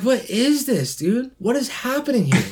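high-pitched baffled questioning; real bewilderment; incredulous. Real, not acted. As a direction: demand to know what you're watching. Bewildered reaction to the video.

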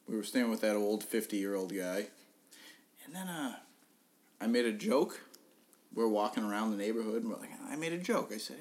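normal talking voice

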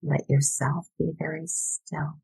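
normal talking voice

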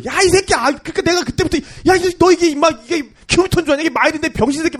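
high-pitched heated scolding; imitated angry cursing. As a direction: cuss out a friend in mock fury. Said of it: "저한테 엄청 욕을 했어요". (높은 목소리로 욕하며 화내는 흉내)

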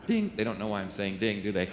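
normal talking voice

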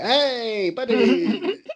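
high, laughing hail to a buddy; mock hearty greeting. Acted greeting in mocked fight.

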